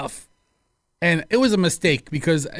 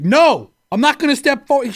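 louder, higher stubborn refusal; defiant customer; 6 semitones higher, 4 dB louder than usual. Retold or imitated. Masked customer refusing the clerk, louder. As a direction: dig in and refuse. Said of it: "He's like".

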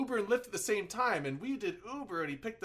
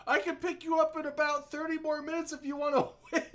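mock driver; 8 semitones higher than usual. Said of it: "and he's like".

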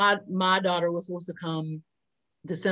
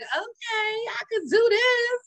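high pumped-up pep; slower than usual. Retold or imitated. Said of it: "I was like".

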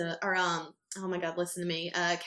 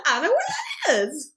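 much higher and louder, slow, laughing through it; laughing mock reply; 13 semitones higher, 7 dB louder than usual. Answering the fan about Anderson, slow laughing high voice. Mimicking her clueless self.

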